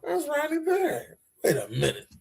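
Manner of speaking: retold surprise. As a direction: relive a hushed moment of surprise. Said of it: "Surprised me when I was sitting there watching it".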